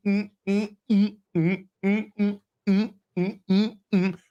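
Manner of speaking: hummed mock sword clashes; mm-mm fight sound effects; humming fight noises. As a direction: hum out a choreographed fight. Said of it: "this choreographed".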